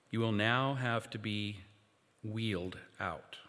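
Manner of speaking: hushed meditation guide; guided meditation. Acted. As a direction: lead them gently through the imagining. Guided imagination exercise.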